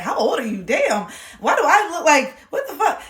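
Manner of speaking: loud, high incredulous outburst; half-laughing shocked exclaim; shocked disbelief. Retold or imitated. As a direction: gawk at how grown she looks. Mocking grown-looking girls.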